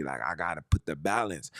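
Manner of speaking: normal talking voice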